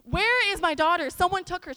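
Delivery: loud, high cry of a distraught mum; grieving mother. Retold or imitated. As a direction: imitate a grieving mother demanding her child, loudly. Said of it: "she was grieving 'cause she's thinking".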